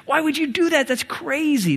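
moral appeal. Acted. Preacher voicing Paul's challenge to believers, incredulous moral appeal. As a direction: climb in pitch toward a disbelieving "Why would you do that?". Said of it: "He's making a moral appeal".

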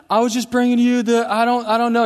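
flustered panic. Retold or imitated. Flustered teen answering armed cop.

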